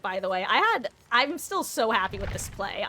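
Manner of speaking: ordinary delivery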